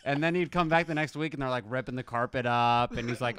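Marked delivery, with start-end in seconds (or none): none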